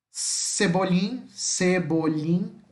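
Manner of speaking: "Cebolim" drawn out very slowly with the LL as LH; Spanish teacher drilling "Cebolim" slowly; Spanish LL sound. Shown as a demonstration. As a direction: stretch "Cebolim" slowly, the LL like Portuguese LH. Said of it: "Ó os dois L's novamente, que tem o som de L H".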